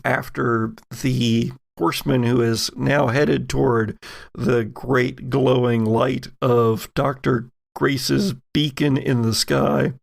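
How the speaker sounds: ordinary talk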